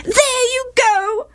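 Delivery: very high, drawn-out wail. A narrator's reading. Wife complains to Gilead, wailing. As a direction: wail the accusation slowly at high pitch. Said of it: "wailed Gilead's wife".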